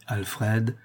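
ordinary delivery, nothing marked

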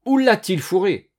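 high, loud indignation; outraged cry. A narrator's reading. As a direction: shout furiously after the culprit. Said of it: "cria le vieux".

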